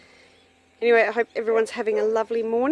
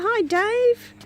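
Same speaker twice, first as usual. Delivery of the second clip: high, loud sing-song call to the dog; 14 semitones higher, 6 dB louder than usual. Real, not acted. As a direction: call Dave from afar, much higher. Greeting dog across paddock.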